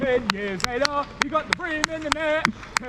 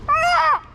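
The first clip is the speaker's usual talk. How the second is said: loud squawking bird call; heron call; 7 dB louder than usual. Pretend. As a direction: screech like a grey heron. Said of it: "Do your Grey Heron voice".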